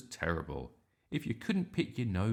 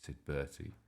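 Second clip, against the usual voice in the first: ordinary delivery, nothing marked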